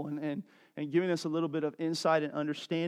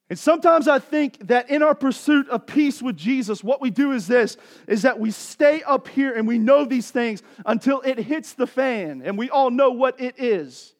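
louder, impassioned preaching; 7 semitones higher, 10 dB louder than usual. Acted. Pastor urging his congregation to trust Jesus, impassioned preaching. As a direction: preach it out to the church.

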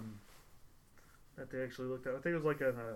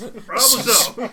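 laughing; 7 semitones higher, 16 dB louder, much slower than usual. Acted. Joking about society collapsing.

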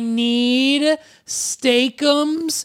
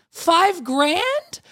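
high disbelieving rise; incredulous; 8 semitones higher than usual. Acted. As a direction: scoff at the small prize. Repeating the prize amount, higher.

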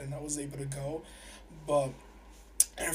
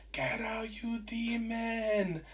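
mock yell; 7 semitones higher, slower than usual. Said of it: "yelling at my stomach".

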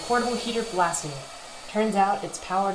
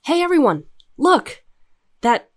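loud, high call to all; excited call; 4 semitones higher, 9 dB louder than usual. Acted. Crew member calling everyone over, loud call. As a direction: announce it to the room.

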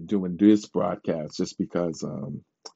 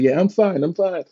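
high reassuring; 9 semitones higher than usual. Retold or imitated. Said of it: "I'm like".

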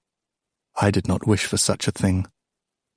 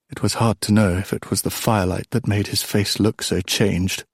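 plain reading voice

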